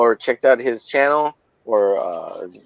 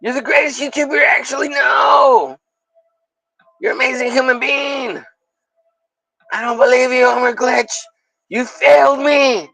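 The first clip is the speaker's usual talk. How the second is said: hammed-up movie duel; 10 semitones higher than usual. Streamer and Peter in a mock sci-fi duel, high shouting. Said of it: "And I'm like".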